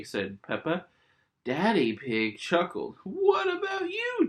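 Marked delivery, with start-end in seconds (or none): 3.0-4.3 very high sing-song call; high playful call; Daddy's call. Acted. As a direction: call across the table playfully. Daddy Pig calling to George.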